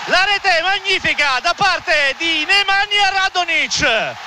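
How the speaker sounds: high-pitched, shouted goal call; breathless italian goal commentary. Real, not acted. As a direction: erupt over the late goal. Live goal call in stoppage time.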